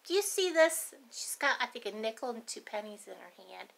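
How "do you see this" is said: put-on higher, curious little girl; lightly voiced kid showing off a coin; a hint of a proud child. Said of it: "and she goes".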